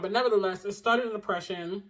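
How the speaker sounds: ordinary talk, nothing marked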